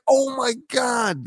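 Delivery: drawn-out high disbelief; mock shocked. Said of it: "And you're just like".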